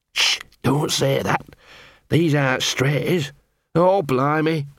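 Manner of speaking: slow, anxious character voice; nervous hushing dog. Acted. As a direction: hush them nervously, drawing words out. Gaspode anxiously hushes Angua.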